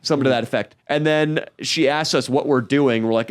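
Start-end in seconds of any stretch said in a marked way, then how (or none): none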